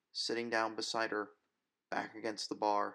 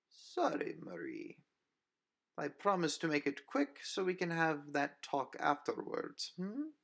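mock-gentle predator, lilting and high; sweetly menacing vampire; 7 semitones higher than usual. Vampire Nina coos a threat to Marie. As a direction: promise a quick kill with a smile.

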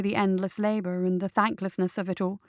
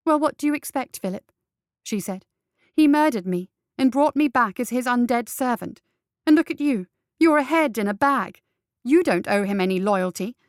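bitter outburst; 3 semitones higher, 4 dB louder than usual. Acted. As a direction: spit out the grievance. Undead servant's bitter outburst.

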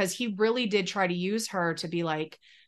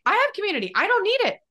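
emphatic retort; 10 semitones higher, faster than usual. Retold or imitated. Retold defiant reply to pastor.

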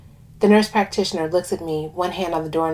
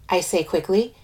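usual speaking voice

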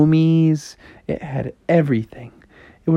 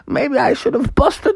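high falsetto wail; yelp, then moan through the hurt; hammed-up cartoon agony; mom in pain. Mom hurt after falling.

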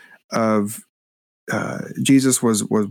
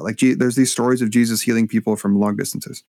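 ordinary talk, nothing marked

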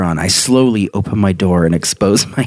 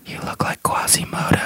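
whisper; 8 dB quieter than usual. A narrator's reading. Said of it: "whispered into her ear".

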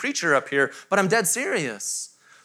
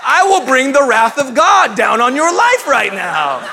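loud, slow, higher mock thunder with a laugh; mock wrathful threat; 4 semitones higher, 6 dB louder, slower than usual. Dad jokingly threatening his sassy toddler, hammed-up wrath. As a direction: ham up a fire and brimstone threat. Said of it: "I was like".

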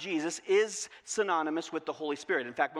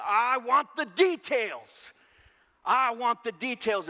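demanding; 6 semitones higher, 7 dB louder, slower than usual. Retold or imitated. Congregation demanding answers.

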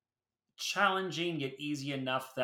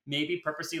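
ordinary talk, nothing marked

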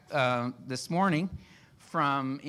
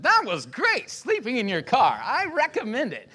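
loud, high, over-bright mock endorsement; hammed-up sales pitch you don't believe; faked enthusiasm; 8 semitones higher, 8 dB louder than usual. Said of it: "You don't have to fake it".

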